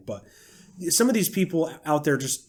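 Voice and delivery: ordinary delivery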